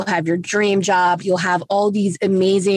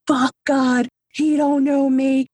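higher-pitched retold resentment at God; mock-defiant grievance, pitched up; resentful, angry; 6 semitones higher, slower than usual. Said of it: "resentful and so angry".

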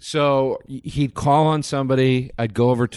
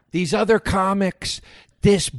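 high, mocking angry rant; shrill stand-up rant aimed at the audience; ranting comic; 9 semitones higher, much slower than usual. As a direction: rail at the crowd in a high tirade. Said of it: "he starts screaming at the audience".

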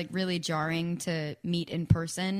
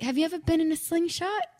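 put-on a shaky, half-hearted Australian accent, pitched higher; attempted Australian accent; 11 semitones higher, 4 dB louder, faster than usual. [name] asking about the slingshot gift, attempted Australian accent. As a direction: try an Australian accent you can't quite do. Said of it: "I can't do an Australian accent".

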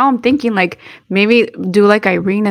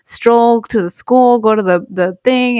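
leisurely drawl; 2 semitones higher, slower than usual. Retold or imitated. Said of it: "he had thought".

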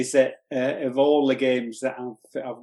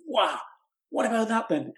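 higher, imitated excitement from a friend; imitating an excited pal; 8 semitones higher, 3 dB quieter than usual. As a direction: mimic a friend gushing over the game, pitched up. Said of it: "he sort of ran down all excited and said".

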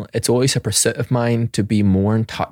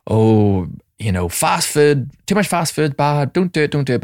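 a hint of a preachy parent, higher; lightly wag a finger at the kids; mock parent lecture; 6 semitones higher than usual. Parent telling kids off about fast food, mock preachy.

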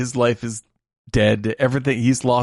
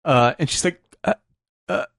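choked up; 9 semitones higher than usual. Real, not acted. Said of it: "I can't do it without crying".

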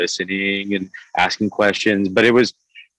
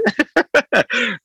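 a high-pitched laugh of disbelief; high burst of laughter. Real, not acted. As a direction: laugh out in shock. Laughing at the surprise.

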